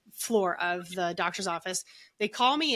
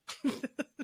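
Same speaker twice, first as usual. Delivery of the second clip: short laugh; 5 semitones higher, 5 dB quieter than usual. Real, not acted. Laughing at her own healing story.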